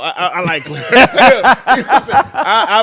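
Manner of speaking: laughing. Real, not acted. Label head laughing about liking clarity, amused insistence. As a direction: laugh while insisting on your point.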